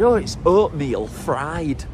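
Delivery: slow, lightly voiced impression of the mum; imitating Carrie's mum. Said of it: "She said".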